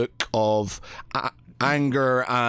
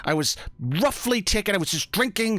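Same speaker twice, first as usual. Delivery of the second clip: panicked denial; 7 semitones higher, faster than usual. Acted. Panicked NPC denies, stammering.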